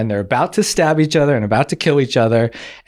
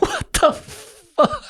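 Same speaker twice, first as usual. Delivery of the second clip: very high, slow, stretched out what; high squeaky stunned dismay; horrified disbelief; 3 dB quieter, much slower than usual. Retold or imitated. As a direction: let it drag up in shocked disbelief. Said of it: "I was like".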